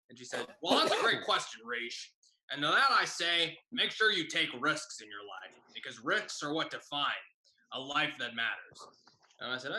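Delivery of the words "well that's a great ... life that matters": imitated guttural teacher giving life advice. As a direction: advise like the glottal teacher. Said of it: "she sounded like this, very guttural".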